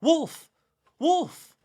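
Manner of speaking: loud, very high single-word alarm calls; crying out. A narrator's reading. Boy calling the village as lambs are taken, high loud cry. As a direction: cry out for help, high and hard. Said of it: "the boy blew his horn and cried".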